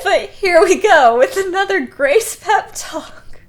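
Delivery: high, loud, slow laughing sigh. Real, not acted. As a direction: laugh through it, fondly exasperated. Laughing at the character's pep talk.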